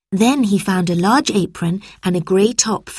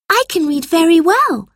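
high-pitched, assured reply; confident young girl; 11 semitones higher, 4 dB louder than usual. A narrator's reading. Lucy answers the teacher, high confident girlish voice. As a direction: answer back in a high child's voice.

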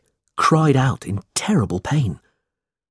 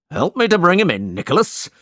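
higher, fast order from the schoolmaster; barked order; 8 semitones higher, 4 dB louder, faster than usual. A narrator's reading. Squeers orders Nicholas to help, higher and fast. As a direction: snap out a command. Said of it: "shouted Mister Squeers".

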